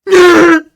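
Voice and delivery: big open laugh, loud and high; loud burst of laughter. Real, not acted. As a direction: crack up out loud.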